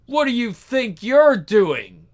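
ordinary delivery

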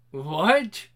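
ordinary talk, nothing marked

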